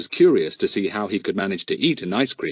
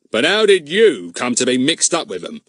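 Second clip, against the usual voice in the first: suspicious; 3 semitones higher, 5 dB louder than usual. A narrator's reading. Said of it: "asked Mister Goon suspiciously".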